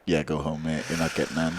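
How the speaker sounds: low-key bouncer voice; bouncer's refusal. Retold or imitated. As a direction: quietly send the drunk home. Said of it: "the bouncer just looks at Luke and is like".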